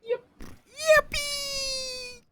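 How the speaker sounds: high-pitched happy squeal; excited squeal. Real, not acted. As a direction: squeal it out with delight. Cheering as the game starts.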